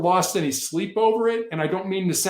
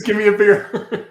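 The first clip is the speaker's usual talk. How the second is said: louder, higher, slower, laughing; mock demand; 5 semitones higher, 8 dB louder, slower than usual. Negotiator laughingly voicing the hostage taker's demand, a hammed-up loud mock demand. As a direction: ham it up while laughing.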